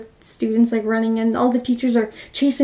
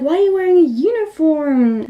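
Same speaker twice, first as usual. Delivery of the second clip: high-pitched, incredulous question; acted puzzled surprise; 5 semitones higher than usual. Tomoko asking Mitsuko about her uniform, acted surprise. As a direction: pitch it up as if baffled. Officer questioning the girl's clothes.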